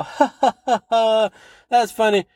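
high-pitched put-on ha-ha; fake laugh. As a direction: humor them with an obviously faked laugh. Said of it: "and just go".

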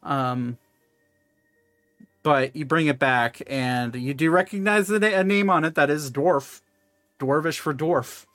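normal talking voice

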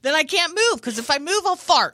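high, loud flustered arguing; mock panicky protest. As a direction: argue back loudly, comically desperate. Acted driver arguing back at carjacker.

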